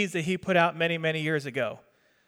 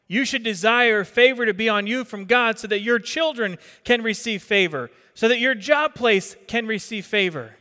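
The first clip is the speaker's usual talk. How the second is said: building preacher cadence, a touch louder and higher; rising preaching; 3 semitones higher, 3 dB louder than usual. Acted. Pastor urging her church to seek God's favor, rising preaching. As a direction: press the congregation harder with each phrase.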